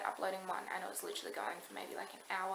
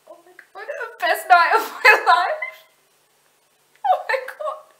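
ecstatic; 12 semitones higher, 20 dB louder, slower than usual. Real, not acted. Screaming in joy, laughing.

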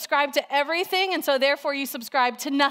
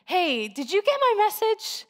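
high, sweet imitation of a friend; sweet and kind; 9 semitones higher than usual. Friend checking on a missed text, imitated sweetly and high. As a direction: ask gently in a lifted, friendly voice. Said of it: "so sweet and in the kindest way".

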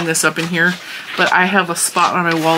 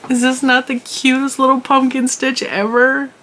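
higher, cutesy delighted coo; cooing over something cute; 6 semitones higher than usual. Real, not acted. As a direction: coo like at a puppy.

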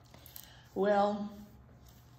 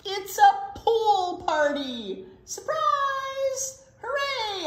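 loud, high jubilant shout; excited party cheer; 13 semitones higher, 8 dB louder, much slower than usual. A narrator's reading. Animals surprise Skunk with a party, excited cheer. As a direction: cheer it out, drawn out slightly.

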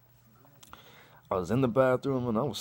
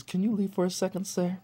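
lightly voiced doctor; 10 semitones higher than usual. Doctor voicing, higher.

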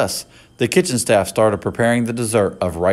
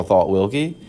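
ordinary delivery

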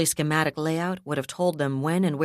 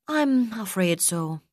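sighing; 5 semitones higher, 3 dB louder, slower than usual. Acted. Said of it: "she sighed".

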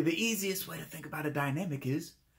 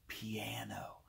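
hushed, far softer voice; piano, soft; 5 semitones lower, 14 dB quieter than usual. Shown as a demonstration. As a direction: drop to a near-whisper. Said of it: "piano means soft".

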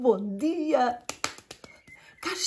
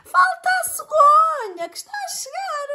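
high shouted call; high excited animal voice; zebra voice; 5 dB louder than usual. A narrator's reading. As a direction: squeal it out high and loud. Zebra voice continues.

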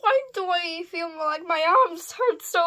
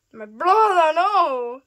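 loud hammed-up cry of loss; mock anguished cry; 3 semitones higher, 7 dB louder, slower than usual. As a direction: wail for the brother. Toy mourns brother.

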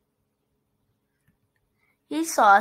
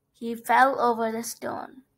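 plain reading voice

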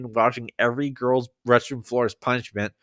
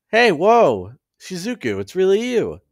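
higher, louder delighted surprise; startled, excited recognition; surprised greeting; 8 semitones higher, 5 dB louder than usual. Acted. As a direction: exclaim it on spotting someone long missed. Surprised reunion in the story.

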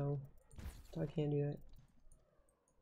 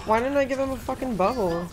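ordinary talk

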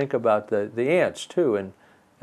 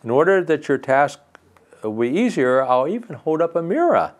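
cheerful brook; 5 semitones higher than usual. Acted. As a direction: sing it out like a happy brook. Brook generously invites fairy.

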